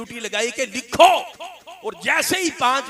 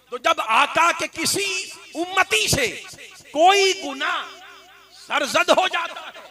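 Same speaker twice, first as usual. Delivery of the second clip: rising, louder sermon cry with a held, slowed close; impassioned preaching; 7 semitones higher, 3 dB louder than usual. Acted. Preacher crying out to his listeners, impassioned. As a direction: preach it out to the crowd. Preacher building to full force.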